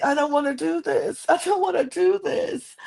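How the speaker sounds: very high-pitched mocking wail; mock crying whine. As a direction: whine it in an exaggerated crybaby voice. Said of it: "She's crying out the gate".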